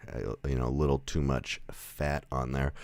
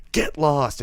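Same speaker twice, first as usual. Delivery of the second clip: raised, louder mock command; gruff dismissal, imitated; 10 semitones higher, 6 dB louder than usual. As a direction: act out sending the guys packing. Clint orders the guys away.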